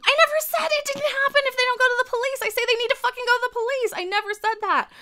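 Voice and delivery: very high-pitched, exasperated yell; furious denial. Real, not acted. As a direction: protest angrily at being misquoted, voice shooting up. Furious denial of misquoted words.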